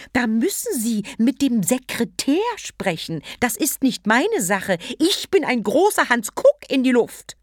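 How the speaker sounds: loud, high snooty imitation; mock a stuck-up official; haughty mimicry. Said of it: "nahm einen hochnäsigen Tonfall an".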